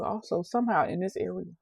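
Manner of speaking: ordinary delivery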